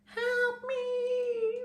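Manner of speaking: tiny, very high sung plea for help; a tiny trapped fairy crying for help; high screechy voice. A narrator's reading. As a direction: squeak it out like a trapped tiny creature. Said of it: "It was high and screechy".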